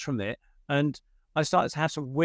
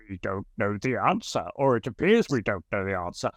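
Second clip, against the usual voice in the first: funny spirit voice; 5 semitones lower than usual. Retold or imitated. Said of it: "putting on a funny voice".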